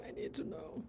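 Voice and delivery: very soft, near-whispered aside; barely voiced murmur; hushed. Real, not acted. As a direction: say it under your breath, almost whispering. Same line repeated, hushed then sung out.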